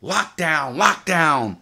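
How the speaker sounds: loud, high barked order; retold guard yell. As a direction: shout it like a guard ordering a lockdown. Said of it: "Guards come over screaming".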